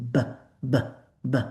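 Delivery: b with voice; buzzing b repeated; voiced b. Shown as a demonstration. Teacher demonstrating voiced b for Arabic speakers. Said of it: "Touch your vocal cords".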